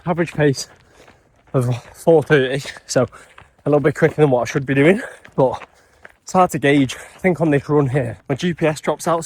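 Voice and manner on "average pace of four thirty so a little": panting between words mid-run; talking while catching breath. Real, not acted. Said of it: "a little out of breath".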